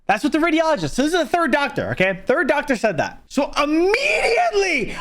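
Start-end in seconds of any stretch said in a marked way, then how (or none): 3.3-5.0 high, slow, laughing through the words; laughing in disbelief at the reversal. Real, not acted. Telling viewers the doctor flipped, laughing.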